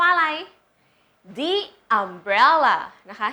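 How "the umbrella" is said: THE as "dee", drawn out before umbrella; correct article sound. Shown as a demonstration. Teacher modelling the answer for students, correct article sound. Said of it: "แต่เราจะพูดว่าอะไร".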